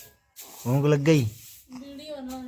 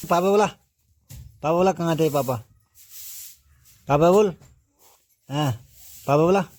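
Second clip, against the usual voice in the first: put-on coaxing baby talk; 3 semitones higher than usual. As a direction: draw it out sweetly to tempt a first word. Mother coaxes baby to say papa.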